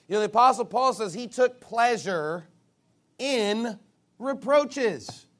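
usual speaking voice

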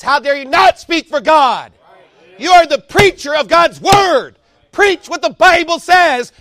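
loud, high shouting sermon; fiery preaching. Acted. Preacher warning his flock to speak for God, shouting. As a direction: thunder it at the congregation.

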